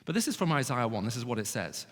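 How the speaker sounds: normal talking voice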